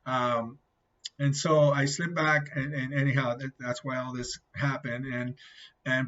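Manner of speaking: ordinary talk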